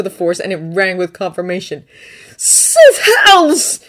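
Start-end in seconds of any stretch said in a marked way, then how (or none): 2.4-3.8 loud, high burst of a curse; shocked curse. Acted. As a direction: blurt it out in alarm.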